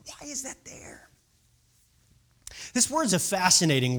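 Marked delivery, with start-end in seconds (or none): 0.1-1.1 hushed, whispered wondering; puzzled whisper. Real, not acted. Preacher questioning the word anger, whispering.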